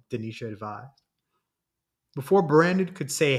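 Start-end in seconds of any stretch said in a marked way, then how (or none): none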